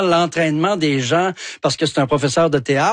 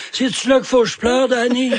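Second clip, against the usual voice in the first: higher-pitched, imitated reluctant guest; 7 semitones higher than usual. Guest with crossed arms asking the host about crying, imitated in a higher voice. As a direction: quote the guest's question, pitched up. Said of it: "puis il était là".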